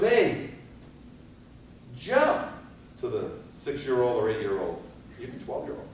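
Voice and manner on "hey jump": put-on high, loud call up to the child; mother calling out. Mother on the street calls up to her child, high loud yell. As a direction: yell up urgently to the kid.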